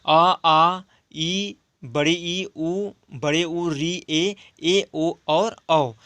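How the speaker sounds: each vowel drawn out slowly and clearly; slow vowel recital. Shown as a demonstration. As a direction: spell the vowels out for the class. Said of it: "इसके उदाहरण हैं".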